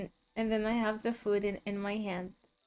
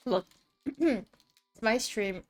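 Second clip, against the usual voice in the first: ordinary talk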